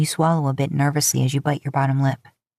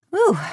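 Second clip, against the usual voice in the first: relieved whew. Real, not acted. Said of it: "author's note exhale".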